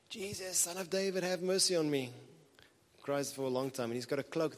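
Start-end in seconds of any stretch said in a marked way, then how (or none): 0.1-2.1 imitating a beggar's high-pitched plea; crying out. Preacher voicing a blind man by the road, crying out. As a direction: call out for mercy, pitched well up. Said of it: "A blind man cries out to him".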